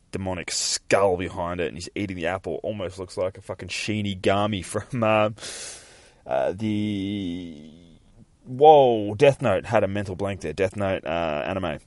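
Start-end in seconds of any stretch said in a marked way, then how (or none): none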